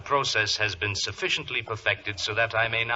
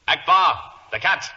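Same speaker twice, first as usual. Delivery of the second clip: sharp command; 7 semitones higher, 9 dB louder than usual. Acted. Villain commands servant to shoot.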